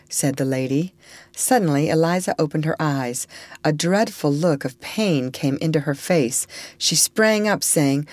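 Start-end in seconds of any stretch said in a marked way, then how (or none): none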